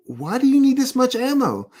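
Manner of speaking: high-pitched retold disbelief; mock astonished question; baffled neighbor. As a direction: ask it like a bewildered neighbor, pitch up.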